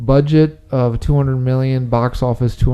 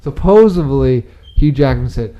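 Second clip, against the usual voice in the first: ordinary delivery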